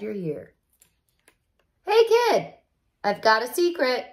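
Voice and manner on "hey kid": put-on much higher, bright kid greeting; Penny's voice. Penny greets the young reader, high excited kid voice. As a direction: call out to the reader like an excited girl.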